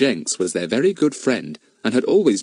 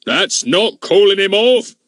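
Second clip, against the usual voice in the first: loud, high angry shout; 11 semitones higher, 6 dB louder than usual. Acted. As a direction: bellow at the boy, losing temper. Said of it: "shouted Mister Goon".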